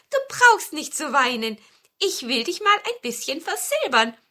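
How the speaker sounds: high, quick-to-comfort storybook dwarf; lightly voiced reassurance; consoling dwarf. A narrator's reading. (leicht gespielter, tröstender Zwerg)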